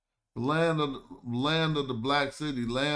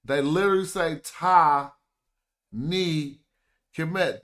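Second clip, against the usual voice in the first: Ta, Ni, Kemet, separated and louder; syllable by syllable; 4 semitones higher, 6 dB louder than usual. Shown as a demonstration. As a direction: tap out each part of Ta Ni Kemet.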